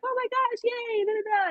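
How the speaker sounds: high, gushing fangirl squeal; excited squeal. Retold or imitated. Tagging her hero online, a high excited squeal. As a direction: squeal with delight at the repost. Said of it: "and I was like".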